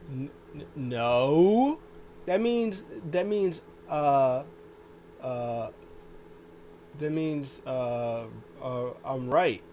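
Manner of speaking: stumbling, stalling denial; mock flustered stammer. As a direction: imitate someone caught out and bluffing. Wrestler caught out, stammering excuse.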